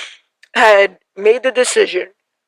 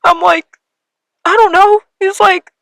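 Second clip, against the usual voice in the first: high, louder, crying through words; 10 semitones higher, 4 dB louder than usual. Real, not acted. Repeating her brother's comforting words while crying. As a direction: voice cracking with real tears. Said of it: "I'm crying again".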